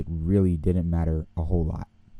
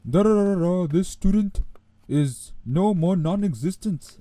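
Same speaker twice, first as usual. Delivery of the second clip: slow, much higher mock-solemn official voice; mock ceremonial pronouncement; 11 semitones higher than usual. Officials sentencing a student's paper to the fire, slow and high mock ceremony. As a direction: pronounce the sentence like a grand ritual.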